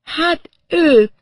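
high, loud, dazed voice; stunned, pitched far up; woman in shock. A narrator's reading. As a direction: answer haltingly from a stupor. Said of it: "rendes sokkot kapott odakint".

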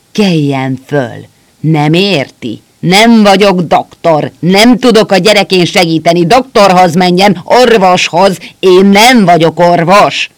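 shouting. A narrator's reading. Doctor shouts at the soldier, loud and furious. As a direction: yell as if at someone deaf. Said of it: "Ordított".

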